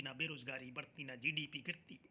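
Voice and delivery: ordinary talk, nothing marked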